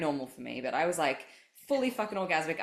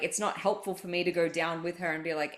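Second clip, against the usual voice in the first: ordinary talk, nothing marked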